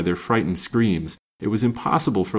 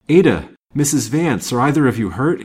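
louder, higher anxious question; 5 semitones higher, 6 dB louder than usual. A narrator's reading. Man to two companions after the crash, anxious. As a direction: call out with urgent concern. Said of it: "he inquired anxiously".

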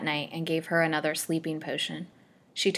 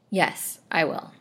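plain reading voice

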